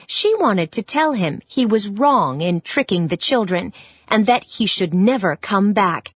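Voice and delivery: plain reading voice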